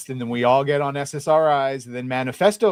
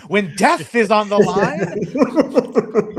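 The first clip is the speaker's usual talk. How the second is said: Vizzini quote; 9 semitones higher, 5 dB louder than usual. Retold or imitated. Quoting Vizzini from Princess Bride.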